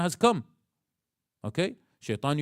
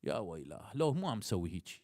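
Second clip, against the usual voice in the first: much softer and lower, a calm inner counsel; gentle voice of the intellect; 7 semitones lower, 12 dB quieter than usual. Retold or imitated. The intellect gently chides Ali after his anger, soft and low. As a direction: hush right down like a kind conscience. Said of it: "the intellect tells you".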